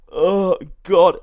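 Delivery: loud, high mock groan; hammed-up moan on waking; groggy waking man. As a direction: groan it like someone coming round. Knocked-out man waking, acted.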